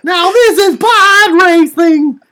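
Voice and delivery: put-on very high, slow, loud little-boy voice; hammed-up child Anakin cheering; Anakin Skywalker voice. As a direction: belt it out like an excited kid. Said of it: "I've been waiting ... for that impression".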